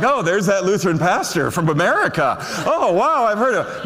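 high, loud, laughing mock greeting; imitating the Pope. Pastor jokingly voices the Pope recognizing him, high laughing delight. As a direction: play the Pope delighted to spot a famous pastor. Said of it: "he's like".

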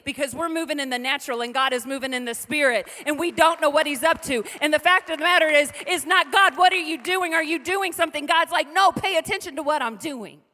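full-force preaching. Acted. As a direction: preach it hard to the congregation.

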